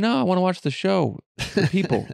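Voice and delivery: high, childish imitation of his son; mock child pleading to dad; kid voice. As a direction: play the little son begging. Son's voice quoted again.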